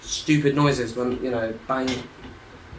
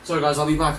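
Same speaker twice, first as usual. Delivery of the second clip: ordinary talk, nothing marked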